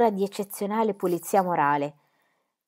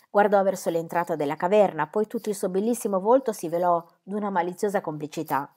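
ordinary delivery, nothing marked

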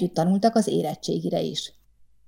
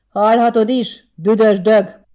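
slow, loud and higher insult; defiant comeback; 4 semitones higher, 7 dB louder, slower than usual. A narrator's reading. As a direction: spit it right back. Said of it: "kontrázott Boldizsár".

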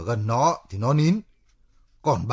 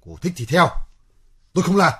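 heated, louder, higher refusal; angry refusal; 6 semitones higher, 4 dB louder, faster than usual. Acted. Man refusing partner's plan in a quarrel, angry. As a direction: refuse flat out, pushing up at the end.

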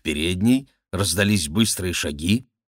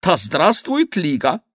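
retold cry; 9 semitones higher than usual. Schomberg confesses his cowardly cheer, retold high cry. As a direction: quote your own shout in a raised voice. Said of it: "Крикнул".

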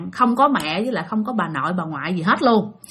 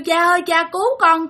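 mock cry for help; 5 semitones higher than usual. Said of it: "con kêu cha quá trời luôn".

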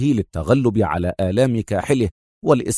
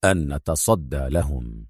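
low, slow, soft and grave; stern; 8 semitones lower, 5 dB quieter than usual. A narrator's reading. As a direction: answer sternly, unhurried and deep. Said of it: "أجابه أدهم في صرامة".

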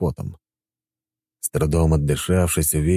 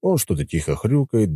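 plain reading voice

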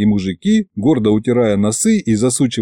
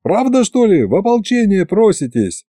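high calling voice to a crowd; shouting to the crowd; 9 semitones higher than usual. A narrator's reading. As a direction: project over the square. Addressing the crowd loudly.

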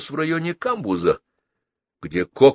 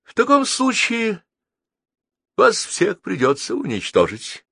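high-pitched, regretful threat; mock-sad threat; 12 semitones higher than usual. A narrator's reading. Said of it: "печально улыбнулся Мамис".

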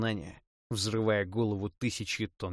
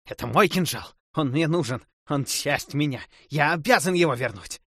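louder and higher, obsessed; frantic pleading; 6 semitones higher, 8 dB louder than usual. Acted. Possessed necromancer crawling after his dagger, loud and frantic. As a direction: clutch at what's yours, frantic.

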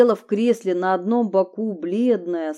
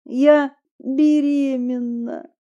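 timid, higher, slow confession; fearful; 5 semitones higher, 4 dB louder, slower than usual. Acted. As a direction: admit it slowly, afraid. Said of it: "со страхом проговорила".

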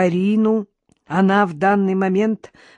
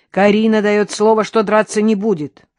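loud, raised announcement; calling out assurance; 4 semitones higher, 6 dB louder than usual. A narrator's reading. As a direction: declare it loudly through the door. Shouting assurance through the door. (громко, через дверь)

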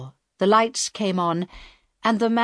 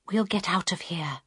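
breath-only, hushed reassurance; whisper; 5 dB quieter than usual. A narrator's reading. Harold promises his fellow captive they will escape, whispering. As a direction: whisper it so the captors can't hear. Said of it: "Harold whispered".

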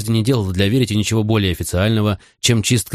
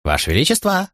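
high jester voice calling out; cheerful fool calling the king; 8 semitones higher than usual. Acted. Fool calls the king awake. (высокий шутовской оклик)

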